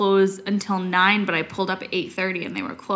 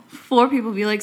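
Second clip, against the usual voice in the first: ordinary delivery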